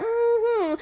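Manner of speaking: high, soft closing hum; shutting-down mm-hmm. Shown as a demonstration. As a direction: close it off with a hum. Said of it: "You start shutting that conversation down".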